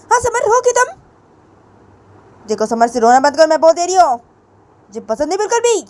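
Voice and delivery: high, loud, repeated commands; insistent scolding. A narrator's reading. Teacher orders the crying student to calm down, scolding. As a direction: start very high, then press the orders firmly.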